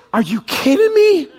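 incredulous, panicked shout at God; exasperated protest. Pretend. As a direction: blurt it out, aghast at the ask. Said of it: "your first thought is".

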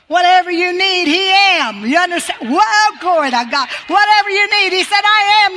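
high, loud, ringing preacher's cry; fiery pulpit preaching; full-force preaching. Acted.

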